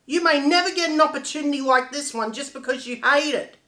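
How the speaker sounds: loud, indignant confrontation; angry outburst. Acted. Confronting Kim over a lost chance with the show, angry outburst. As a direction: lash out, pushing the key words hard.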